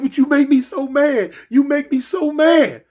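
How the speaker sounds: put-on high, loud, upset girlfriend, last line drawn out; girlfriend's voice. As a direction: whine it up high like she's fed up. Girlfriend voiced in retold fight.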